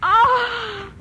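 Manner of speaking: sudden horror at the word morgue; horrified gasps. Acted. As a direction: gasp out in dread. Hears it's the city morgue.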